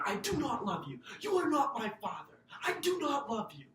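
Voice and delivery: loud, high yell at his father; shouted refusal. Retold or imitated. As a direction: throw each word at him, shouting. Child yelling at his father.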